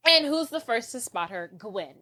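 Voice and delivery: ordinary talk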